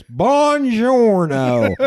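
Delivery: high, long drawn-out Southern drawl on Italian; bad Southern-accented Italian. Imitating Brad Pitt's film Italian, heavily Southern-accented. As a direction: stretch it out in a mangled Southern accent. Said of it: "he goes".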